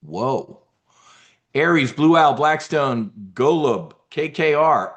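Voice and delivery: normal talking voice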